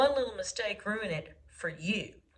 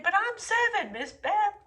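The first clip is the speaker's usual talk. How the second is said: student protest; 10 semitones higher, 5 dB louder than usual. Retold or imitated. Student answers teacher.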